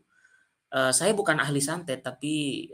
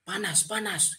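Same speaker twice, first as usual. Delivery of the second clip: higher but softer mock cry; mock scream; 6 semitones higher, 6 dB quieter than usual. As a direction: imitate a possessed person crying out, held back in volume. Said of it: "Dia langsung teriak". (teriakan tiruan yang tertahan)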